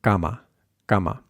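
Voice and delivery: cama with the k from scar; muted Spanish k on cama; unaspirated k. Shown as a demonstration. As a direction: say cama without a puff of air. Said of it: "k sound from scar".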